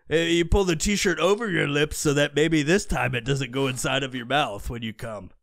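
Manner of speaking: ordinary delivery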